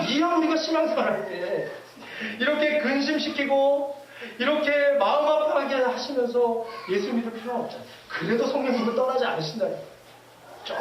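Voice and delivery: ordinary talk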